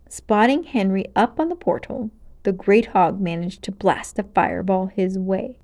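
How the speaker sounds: ordinary delivery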